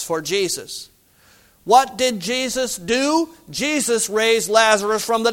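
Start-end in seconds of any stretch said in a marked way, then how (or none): none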